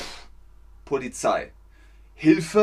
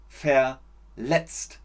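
usual speaking voice

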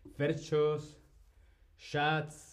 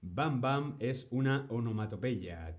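ordinary talk

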